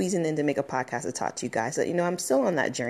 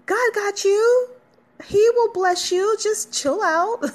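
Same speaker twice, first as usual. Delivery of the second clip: soothing; 5 dB louder, slower than usual. Acted. Reassuring listeners, playful voice.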